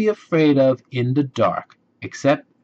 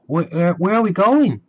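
nervous; 7 semitones higher, 3 dB louder than usual. Acted. Nervous sister asks.

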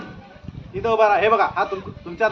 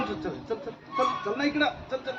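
much softer hurried urging; hushed aside; 11 dB quieter, faster than usual. Real, not acted. Magician softly hurrying the kid over.

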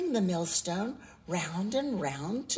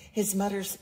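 plain reading voice

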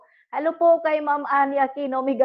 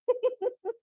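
laugh; 11 semitones higher, 8 dB quieter than usual. Real, not acted. Dodging question about Capre.

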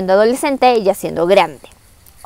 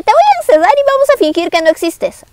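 a higher-pitched, mild mock scolding; regaño del Inca; 9 semitones higher than usual. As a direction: play a father laying down punishment, lightly acted. Inca condena a su hija. (regaño fingido, con tono más agudo)

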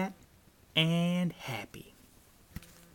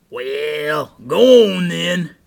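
very loud troll concession; grudging troll; 3 semitones higher, 15 dB louder, slower than usual. A narrator's reading. Troll lets Big Billy pass, loud grudging voice. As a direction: boom it out, punching GO ON.

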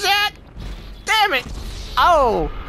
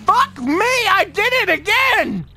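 frustrated yell; 10 semitones higher than usual. Real, not acted. Another failed jump.

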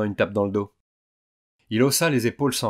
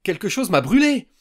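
high, loud yelp of sudden pain; high, loud shocked cry of pain; cry of surprise; 10 semitones higher, 11 dB louder than usual. A narrator's reading. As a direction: yelp as if burned. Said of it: "poussa un cri de surprise".

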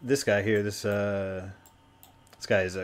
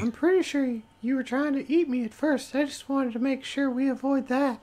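tiny mushroom, very high squeak; Stool's little voice. Acted. Mushroom reassures someone hearing voices, tiny high voice. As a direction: introduce yourself in a small, high creature voice. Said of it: "this little voice in your head".

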